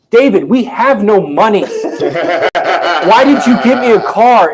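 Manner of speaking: high, loud, laughing disbelief; hammed-up shocked wife; mock upset wife. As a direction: scold him through laughter.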